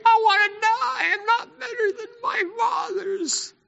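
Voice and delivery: high, hammed-up crying whine; mock wailing. Preacher mocks Elijah the next day, fake crying. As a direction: sob it like a sulking child.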